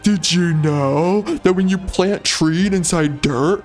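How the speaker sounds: slow, dim-witted mock voice; dopey put-on cutscene voice; brain-dead character. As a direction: spell out trivia slowly like a simpleton. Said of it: "those are the kind of brain dead conversations".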